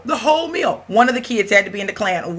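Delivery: normal talking voice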